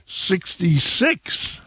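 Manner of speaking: slow, high drawn-out number call; raffle caller. Acted. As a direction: stretch it like a bingo caller.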